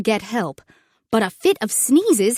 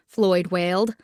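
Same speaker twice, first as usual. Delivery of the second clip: ordinary delivery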